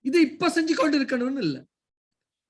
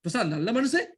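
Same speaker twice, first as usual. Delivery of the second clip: "மனுஷன்" rising at the end in disbelief; questioning tune. Shown as a demonstration. Teacher asking who says he is good, doubtful question. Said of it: "இப்ப கேள்வி".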